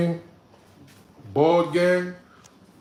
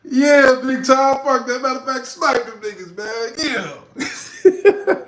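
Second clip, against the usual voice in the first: higher, laughing egging-on; real laughing, pitched up, urging the moderator on; laughing hype; 9 semitones higher, 4 dB louder, slower than usual. Real, not acted. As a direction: cheer him on through laughter.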